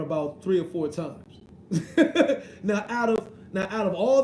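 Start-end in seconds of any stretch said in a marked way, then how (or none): 1.7-2.6 a sudden high, loud laugh bursting out; high burst of laughter. Real, not acted. Laughing loudly to viewers about being drunk on camera. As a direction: laugh out loud at your own claim. Amused at his own drinking claim.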